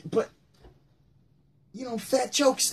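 ordinary talk, nothing marked

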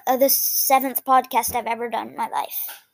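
ordinary talk

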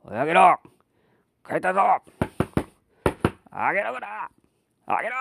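put-on rough drunken yelling father; drunk angry father. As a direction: bellow like a drunk brute at the door. Drunk father banging at night.

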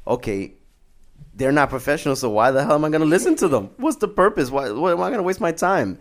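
exasperated skeptic. Retold or imitated. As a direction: demand why you should bother. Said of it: "you're gonna be like".

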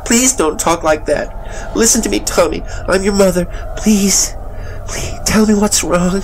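high, loud, terrified mother's plea; desperate, high-pitched imploring; tearful pleading. A narrator's reading. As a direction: fight back tears as you beg your child. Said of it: "fighting back the terror and the tears".

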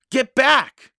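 loud, high, urgent warning; high-pitched alarmed order. A narrator's reading. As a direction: call out sharply to warn her away. Boy urgently warns his sister.

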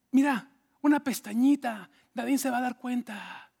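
wheedling put-on seductive voice; coaxing temptress. Potifar's wife tempts José, playful coaxing. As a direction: coax him into a secret. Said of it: "le decía".

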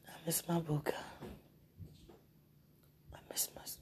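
breathy late-night whisper to a loved one; soft whisper. Real, not acted. Whispering goodnight to her sweetheart on the phone, hushed. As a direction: whisper it close to the phone, tender.

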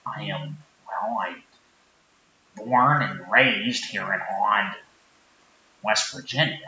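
low-pitched sheepish small-town guy; Rick character. Acted. Rick states hometown.

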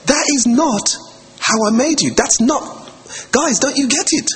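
high, exasperated voice of God. Retold or imitated. God looking down at quarrelling people, high and exasperated. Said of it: "God looks down and thinks".

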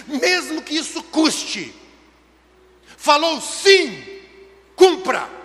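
loud exhortation, key words stretched out; forceful, emphatic preacher's delivery; impassioned preaching. Acted. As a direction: drive each word home from the pulpit.

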